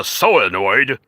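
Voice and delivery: "solenoid" as a drawn-out "soh-uh-noid"; slow, stretched "solenoid", long O and barely any L; mock English pronunciation. Guessing how jolly old England says it, "solenoid" mockingly as "so-a-noid". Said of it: "how they pronounce it in jolly old England".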